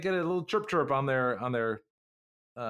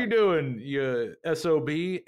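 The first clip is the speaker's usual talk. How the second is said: mock angry radio call; 3 semitones higher, 4 dB louder than usual. McDougan shouting over radio.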